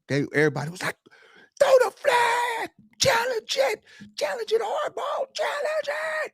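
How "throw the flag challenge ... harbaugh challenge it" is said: high-pitched imitation of a screaming crowd; strained, very high mock scream from the stands; screaming fans. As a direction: yell it like frantic fans at the coach. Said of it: "They screaming".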